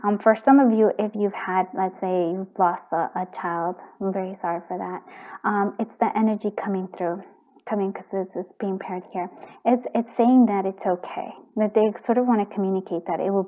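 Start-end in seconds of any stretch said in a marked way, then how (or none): none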